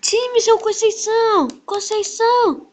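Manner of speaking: loud high call out; calling from far away. Real, not acted. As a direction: call out to get attention. Calling out to another player.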